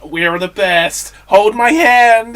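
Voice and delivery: hammed-up Trump, slow and loud; mock Trump. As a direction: drawl it out like a boastful Trump. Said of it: "Trump would be like".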